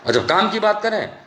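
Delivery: usual speaking voice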